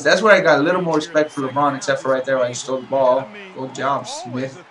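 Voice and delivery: ordinary talk, nothing marked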